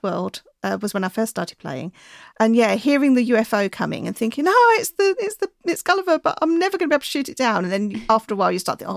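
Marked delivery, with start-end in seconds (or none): 4.5-7.6 high, fast, frustrated excitement; excited. Retold or imitated. Excited thought on hearing UFO.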